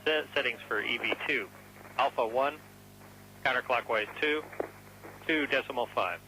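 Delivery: radio transmission. Real, not acted. Capcom reading settings over radio.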